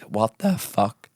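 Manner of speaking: slow, stretched-out mock disbelief; mock shocked reaction. Crowd watching her slide across the stage, stretched-out shock. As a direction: drag it out like the stunned crowd.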